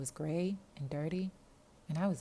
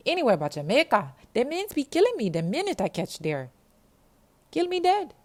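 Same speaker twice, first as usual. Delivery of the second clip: high, loud, panicked Jamaican voice; terrified Jamaican girl begging not to be sent to Jamaica; frightened Jamaican girl; 10 semitones higher, 11 dB louder than usual. Acted. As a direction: plead that she'll be killed back home.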